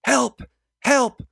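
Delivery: very high, loud cries; screaming for help. A narrator's reading. Mari screams to passers-by for help, high loud cries. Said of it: "She starts screaming".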